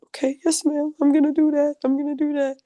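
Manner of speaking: high, eager, dutiful yes-ma'am; very high, eager promise to a motherly friend; eager obedience. Retold or imitated. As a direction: chirp back like an obedient kid to a loving elder. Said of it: "And I was like".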